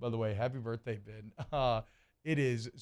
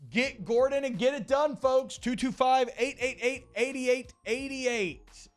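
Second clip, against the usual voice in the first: hyped ad read; 10 semitones higher, 4 dB louder, slower than usual. Acted. Reads sponsor slogan and number.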